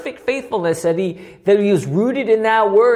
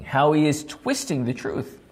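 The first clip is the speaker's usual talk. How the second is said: ordinary delivery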